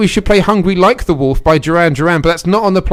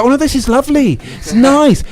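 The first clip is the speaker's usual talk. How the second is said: high-pitched, put-on politeness; politely pretending English person; 8 semitones higher than usual. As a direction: fake delight over awful food. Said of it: "if you give any food to an English person".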